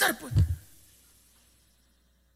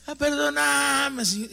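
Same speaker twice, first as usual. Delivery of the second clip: high, slow, hammed-up begging; drawn-out mock apology, pitched high; mock pleading drunk; 9 semitones higher, 5 dB louder, slower than usual. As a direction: plead like a pitiful drunk asking forgiveness. Mock plea of a repentant drunk.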